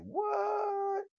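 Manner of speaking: high, sassy boasting rant; sing-song boast. Pretend. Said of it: "I'm like".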